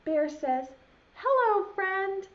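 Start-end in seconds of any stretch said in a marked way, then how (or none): 1.1-2.3 Bear's voice. Acted. Bear greets the owl.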